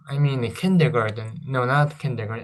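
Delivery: usual speaking voice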